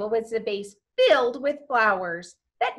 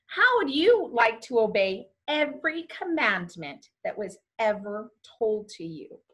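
ordinary delivery, nothing marked

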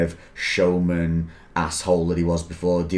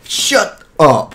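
loud, high amazed outburst; real disbelief; 8 semitones higher, 6 dB louder than usual. Real, not acted. Friend learns he was in the film, astonished. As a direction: blurt it out, astonished.

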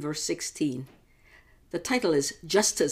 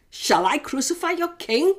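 challenging governor; 9 semitones higher than usual. Acted. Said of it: "Pilate asked".

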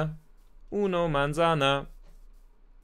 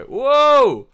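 high, loud cry of alarm; sudden yelp breaking off the chat; real surprised outburst; startled shout; 12 semitones higher, 12 dB louder than usual. Real, not acted. Startled by bulls mid-sentence.

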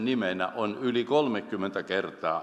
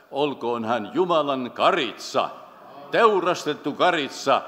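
raised, louder pulpit proclamation; fervent preaching; 3 semitones higher, 3 dB louder than usual. Real, not acted. Preacher exalts the slain Lamb to the congregation, fervent and emphatic. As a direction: proclaim it with rising force and pitch. Sermon builds to climax.

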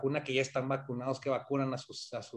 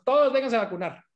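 mock loud invitation; 6 semitones higher, 4 dB louder than usual. Mimicking US vaccine invitation.

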